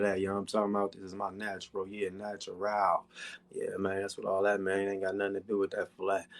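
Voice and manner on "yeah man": ordinary talk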